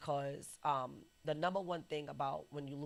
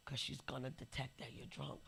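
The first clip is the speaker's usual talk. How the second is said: hushed warning; 2 semitones lower, 8 dB quieter than usual. Retold or imitated. Brother secretly warns at funeral.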